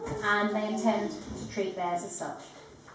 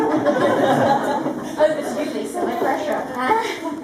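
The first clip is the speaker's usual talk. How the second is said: laughing; 5 semitones higher, 8 dB louder, much slower than usual. Real, not acted. Teasing handover to the reader.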